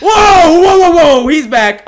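high, very loud alarm; startled shouting. Real, not acted. Player seeing he's back, startled shouting. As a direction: yell out in sudden shock. Monster suddenly returns.

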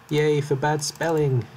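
mock-cheerful, high and loud; sarcastic cheer. Real, not acted. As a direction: cheer ironically at the mistake. Mock cheer at own mistake.

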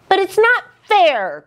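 high, aggrieved wail; high-pitched childlike protest; protesting cry. A narrator's reading. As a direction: cry out at the injustice. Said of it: "cried Little Blue".

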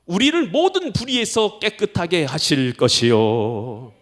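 slow, solemn Bible reading; scripture reading. Acted. Preacher reads 1 John 1:9 to the congregation, slow and solemn. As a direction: read each phrase of the verse slowly.

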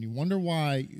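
usual speaking voice

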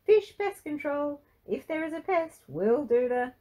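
chirpy dad answering the business phone, bright and loud; chirpy phone greeting. A narrator's reading. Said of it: "A chirpy Ron Fish answered the phone".

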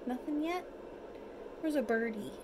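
ordinary delivery

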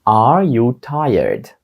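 slow, each word drawn out; slow model question. Shown as a demonstration. Teacher modelling the question for students, slow and careful. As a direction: stretch it for learners. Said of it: "teacher models slow, then normal".

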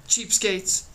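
parting yell. A narrator's reading. Girl to the people on the bluff, parting yell. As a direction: call the insult out as a parting shot. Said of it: "She gave a parting yell".

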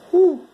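sharp high whew of heat; fanning oneself. Real, not acted. Wire getting hot near him.

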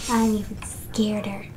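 playful whispered fear; mock scared whisper. Whispered reply in scary level.

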